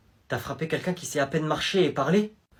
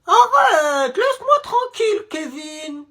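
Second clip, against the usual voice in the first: high, crying plea, nearly shouting; boy retelling how he begged Kevin; whiny plea; 14 semitones higher, 4 dB louder, much slower than usual. Retold or imitated. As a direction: beg the bully to stop. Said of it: "je lui ai dit".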